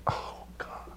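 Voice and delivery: breathless frightened whisper; mock overwhelmed whisper; whispered dread. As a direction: gasp it out under your breath.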